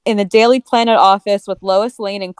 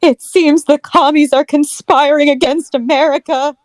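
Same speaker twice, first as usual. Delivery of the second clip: 1950s soap opera voice; 7 semitones higher, 3 dB louder than usual. Acted. Acting Lois in soap voice.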